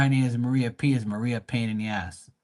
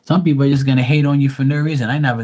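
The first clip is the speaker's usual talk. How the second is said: louder, faster rant; emphatic rant; 4 semitones higher, 8 dB louder, faster than usual. Real, not acted. Telling Maria about haters, loud emphatic rant. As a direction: push it out, fired up about haters.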